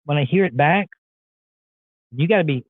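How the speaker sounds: normal talking voice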